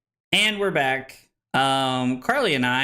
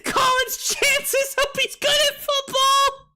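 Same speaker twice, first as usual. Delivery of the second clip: tipsy, laughing high squeal; slurred, giggly and tipsy. Real, not acted. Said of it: "My face is numb".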